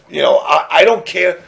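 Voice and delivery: usual speaking voice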